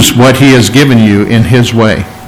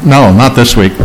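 ordinary talk, nothing marked

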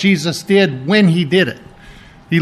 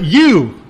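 YOU stressed and set apart; emphatic YOU; 4 dB louder than usual. Shown as a demonstration. Preacher returns to the verse's pronoun, emphatic YOU. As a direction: land firmly on YOU. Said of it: "is very emphatic".

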